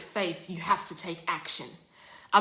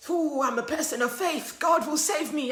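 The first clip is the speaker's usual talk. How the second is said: higher, sing-song mock serenity; mock serene faith; 7 semitones higher than usual. Stan on the roof, higher mock serenity. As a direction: play him smugly calm.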